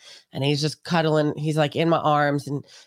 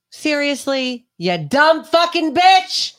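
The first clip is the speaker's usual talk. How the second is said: much higher, louder, slow and furious; scolding the dead chicken, loud and slow; exasperated yell; 10 semitones higher, 7 dB louder, much slower than usual. Real, not acted. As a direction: vent it all at the dumb bird.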